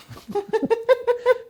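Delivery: high-pitched giggling laugh; real laugh at the coincidence; high burst of laughter. Real, not acted. Bursts out laughing mid-story.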